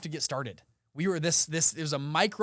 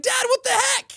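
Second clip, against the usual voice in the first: outraged; 8 semitones higher, 8 dB louder than usual. Retold or imitated. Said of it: "I was like".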